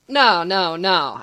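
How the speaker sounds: loud, emphatic objection; protesting. A narrator's reading. Patient cutting in to correct a teammate, loud protest. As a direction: protest loudly, hammering each word. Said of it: "Alec protested".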